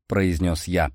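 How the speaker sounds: usual speaking voice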